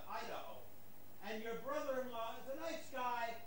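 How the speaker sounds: distant, off-mic voice; speaking from across the room; far from mic. Real, not acted. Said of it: "is the camera picking me up".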